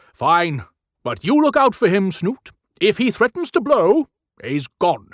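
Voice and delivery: lightly voiced firm old man; Santa's voice. Narrator voices Santa character.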